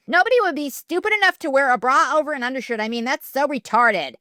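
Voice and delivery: mocking taunt. A narrator's reading. Mean girl jeering at Lainey in the stall. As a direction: jeer at her.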